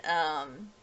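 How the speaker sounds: normal talking voice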